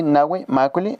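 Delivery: ordinary talk, nothing marked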